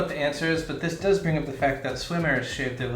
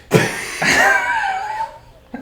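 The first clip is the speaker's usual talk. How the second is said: bursting out in laughter; loud, high, helpless laugh; 12 dB louder than usual. Real, not acted. Laughs after ghost voice.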